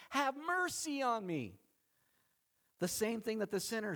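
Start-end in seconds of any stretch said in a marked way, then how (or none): 0.1-1.6 high, pleading call; crying out. Acted. Blind beggar appeals to Christ, crying out. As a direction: cry out for mercy, pitched up. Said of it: "so he cries out to him".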